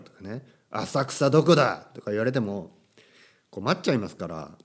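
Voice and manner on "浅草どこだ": louder, slow gangster demand; yakuza tough talk. Pretend. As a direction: bark it like a street thug. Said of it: "そういう話し方は真似しないように".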